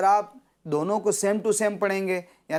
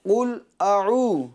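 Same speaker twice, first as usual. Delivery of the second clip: slow, measured model for repeating; recitation drill; 3 semitones higher, much slower than usual. Shown as a demonstration. Teacher asking pupils to repeat, slow recitation drill. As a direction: recite it slowly, each syllable held.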